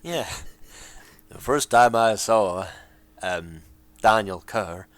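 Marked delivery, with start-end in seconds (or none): 1.3-4.9 put-on slow, low Scottish accent slipping in; a put-on Scottish lilt. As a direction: let the Scot come out, slowly. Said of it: "Decide what accent you're doing".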